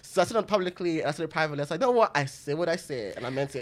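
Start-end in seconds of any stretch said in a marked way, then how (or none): 1.8-3.6 understated, unrepentant self-quote, sinking in pitch; retold defiant stance. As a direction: drop low and quiet, slowing down. Retells own defiant stance on tweet.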